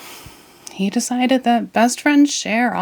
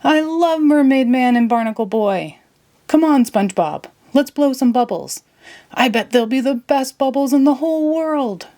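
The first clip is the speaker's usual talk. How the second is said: excited Patrick; 7 semitones higher than usual. Acted. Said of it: "with excitement".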